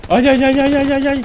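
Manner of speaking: high, loud run of ay cries; excited wail. Real, not acted. Streamer crying out as a player charges. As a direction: cry out in suspense. Reacting to tense moment.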